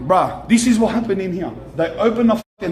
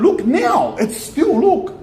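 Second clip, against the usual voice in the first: excited; 9 semitones higher, slower than usual. Real, not acted. Breaks off story to point at shop.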